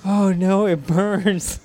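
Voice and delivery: high, drawn-out mock wail; hammed-up cry of distress; retold panicked girlfriend. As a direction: imitate a woman crying out, half amused. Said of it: "And she was like".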